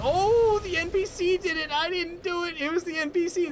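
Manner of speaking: high, bright disbelief at the lucky kill; giddy high-pitched excitement; excited. Real, not acted. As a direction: squeal it out, you can't believe it. Sudden explosion kills boss.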